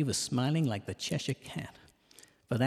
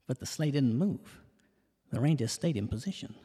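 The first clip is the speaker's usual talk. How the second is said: usual speaking voice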